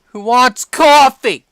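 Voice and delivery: loud sing-song call across the room; hollering an offer of coffee. Real, not acted. Calling everyone loudly for coffee.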